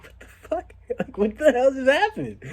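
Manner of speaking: high, disbelieving what-the-hell; high incredulous outburst; stunned disbelief. Retold or imitated. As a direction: react in total shock. Shocked reaction in story.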